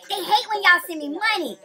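big hype shout to the viewers; loud hype plug. Real, not acted.

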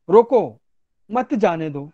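रोको punched, a pause, then मत जाने दो; pause after रोको. Shown as a demonstration. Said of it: "थोड़ा समय के लिए पॉज ले रहा हूं". (रोको के बाद विराम)

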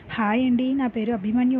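ordinary delivery

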